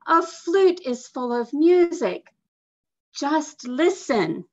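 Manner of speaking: bursting to show her flute; excited girl. A narrator's reading. Girl tells mother about flute, high and eager.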